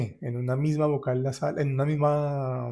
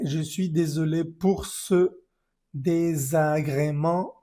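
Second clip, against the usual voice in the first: slow reading; 3 semitones higher, slower than usual. Shown as a demonstration. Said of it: "esta palabra se pronuncia désagrément".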